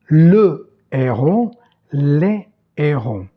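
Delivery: slow "les héros", no z sound between the words; no liaison. Shown as a demonstration. Teacher explaining the French aspirated H, no liaison. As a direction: keep "les" and "héros" apart, unlinked. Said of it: "H aspiré qui empêche en français la liaison".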